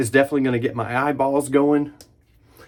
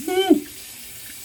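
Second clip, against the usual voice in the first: high breathy mm from cold water. Real, not acted. Cold water splash on face.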